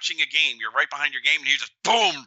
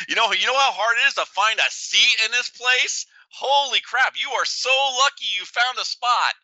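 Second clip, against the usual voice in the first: sarcastic mock politeness; 8 semitones higher than usual. [name] retells his sarcastic jab.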